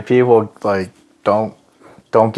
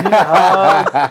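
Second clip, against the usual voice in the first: higher, louder, slow, laughing mockery of a concerned friend; mock sappy hug offer; 10 semitones higher, 4 dB louder, slower than usual. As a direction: ham up a syrupy plea for a hug. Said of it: "they're like".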